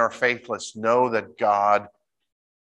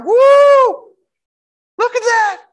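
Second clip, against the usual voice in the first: much higher, louder whooping shout; hooting and hollering; 11 dB louder, much slower than usual. Retold or imitated. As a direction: whoop with joy at the sky. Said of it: "he starts hooting and hollering".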